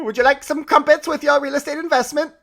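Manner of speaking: high, hammed-up posh London accent; bad London accent. Host jokes about London investors in a terrible London accent.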